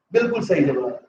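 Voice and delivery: ordinary talk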